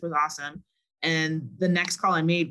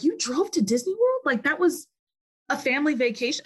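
high, incredulous mock question; amazed friends; 9 semitones higher than usual. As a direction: play the stunned foreigner hearing this. Friends amazed at road trip.

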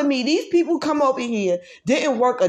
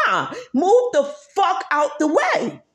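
angry shout; 7 semitones higher than usual. Real, not acted. Angry demand after mocking politeness.

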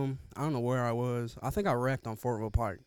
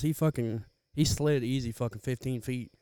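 normal talking voice

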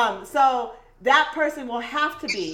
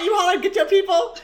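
amazed; 10 semitones higher, 5 dB louder, faster than usual. Real, not acted. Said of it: "you actually hollered that?".

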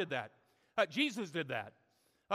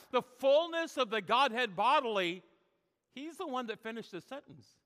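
usual speaking voice

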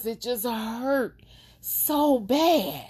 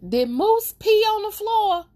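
much higher, louder shocked question; 13 semitones higher, 6 dB louder than usual. Retold or imitated. Finding water on the floor, high shocked squeal. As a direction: squeal it in disbelief. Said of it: "I'm like".

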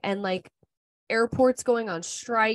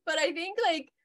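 laughing; 11 semitones higher, 5 dB louder than usual. Real, not acted. Laughing while telling the story.

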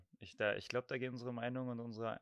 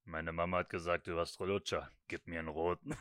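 put-on lower, heavy little boy voice; very deep voice; 6 semitones lower than usual. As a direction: drop the voice low and heavy. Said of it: "mit so einer übelst tiefen Stimme".

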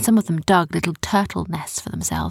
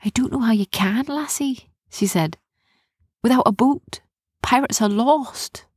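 slightly higher, despairing lilt; pirate girl Erin; 5 semitones higher than usual. A narrator's reading. Erin doubting rescue without a boat, despondent. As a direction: sigh it out as a stranded pirate.

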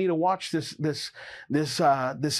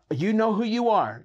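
higher, louder emphatic call; 5 semitones higher, 3 dB louder than usual. Acted. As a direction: point straight at them.

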